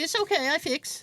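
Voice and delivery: lightly mimicked handyman in broken English; imitating Louis. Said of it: "He's like".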